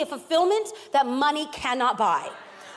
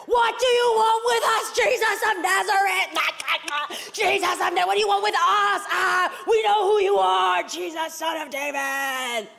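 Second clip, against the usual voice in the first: possessed man crying out; 5 semitones higher than usual. A narrator's reading. Possessed man confronts Jesus in the synagogue, crying out wildly. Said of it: "cried out".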